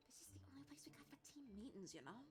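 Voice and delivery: hushed, quick whisper. Acted. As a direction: whisper it fast, for teammates' ears only. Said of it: "lowers her voice".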